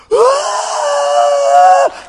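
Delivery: high, loud cry of amazement as the arms rise; joyful cry. Retold or imitated. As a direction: let out a sudden joyful yelp. Healed woman lifts her arms.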